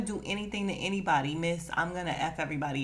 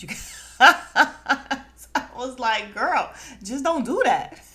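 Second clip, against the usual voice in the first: laughing disbelief; 6 semitones higher, 9 dB louder, slower than usual. Real, not acted. Laughing retort to the scene.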